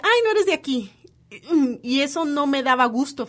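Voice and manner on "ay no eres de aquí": high, loud sing-song "you're not from here"; imitating locals. Locals remarking on the Colombian newcomer's accent, high mock sing-song. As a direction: mimic the locals noticing her accent. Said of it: "todo el mundo decía".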